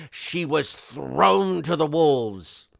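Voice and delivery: slow, stretched-out melodrama; mock-dramatic reading. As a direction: draw out "thrown" for theatrical effect. Reads letter's line with mock drama.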